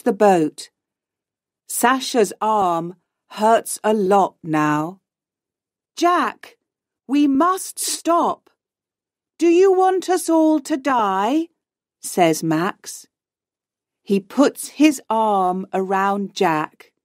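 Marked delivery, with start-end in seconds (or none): 6.0-11.5 high-pitched urging; urgent plea. A narrator's reading. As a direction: plead with a friend to stop, pitched high. Urging friend to abandon dangerous search.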